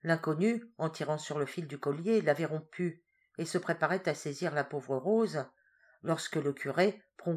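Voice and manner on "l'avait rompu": ordinary delivery, nothing marked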